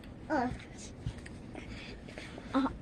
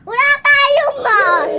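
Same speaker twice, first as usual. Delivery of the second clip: loud slow exclamation; 9 semitones higher, 13 dB louder, much slower than usual. Pretend. Pretend emergency, loud slow line.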